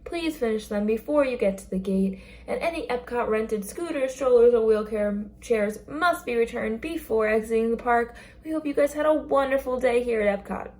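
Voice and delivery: mock Epcot exit announcement; park announcement spiel. Shown as a demonstration. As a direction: read it like the closing park announcement over the speakers. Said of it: "So like that, but smoother".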